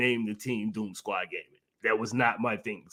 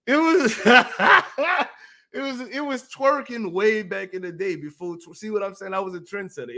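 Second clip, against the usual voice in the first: laughing; 8 semitones higher, 3 dB louder than usual. Real, not acted. Laughing at his own song.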